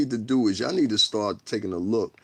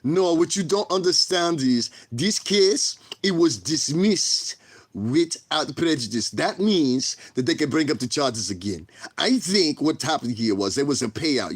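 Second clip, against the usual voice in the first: slow, raised-pitch imitation French accent; mock French accent; 5 semitones higher, slower than usual. Quoting a podcaster on the dismissed case, mock French accent. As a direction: put on an exaggerated French accent, drawing words out. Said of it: "let me say it in the accent".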